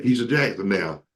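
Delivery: ordinary talk, nothing marked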